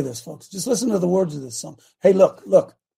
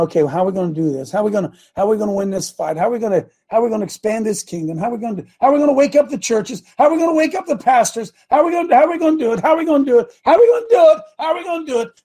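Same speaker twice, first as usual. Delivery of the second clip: rising higher and louder until shouting, fast; building frantic questions; 5 semitones higher, 4 dB louder, faster than usual. Retold or imitated.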